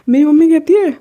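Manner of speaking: usual speaking voice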